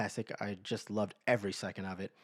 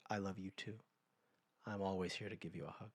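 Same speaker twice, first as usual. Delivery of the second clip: tender reply; 9 dB quieter than usual. Retold or imitated. Friend softly answering the man who just said I love you, tender. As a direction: say it gently, like a quiet promise.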